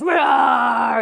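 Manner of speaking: loud mock monster roar. Acting a monster to scare a puppet, playful roar. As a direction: roar like a big scary monster. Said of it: "big scary monster comes in and goes".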